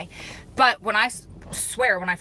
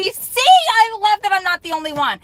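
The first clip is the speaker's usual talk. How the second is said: excited; 12 semitones higher, 10 dB louder than usual. Real, not acted. Excited reply to a comment.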